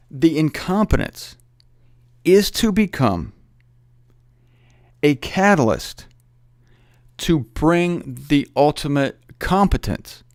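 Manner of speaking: slow, weighty phrase by phrase; deliberate slow teaching cadence; slow emphatic. Real, not acted. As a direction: lay it out word by word.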